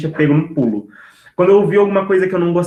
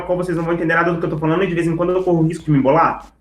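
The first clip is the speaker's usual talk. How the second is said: very fast, words tumbling together; rushed speech demo; much faster than usual. Shown as a demonstration. Speaker showing the audience how too-fast talk sounds. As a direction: rattle it off too fast to follow. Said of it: "falar com vocês dessa forma".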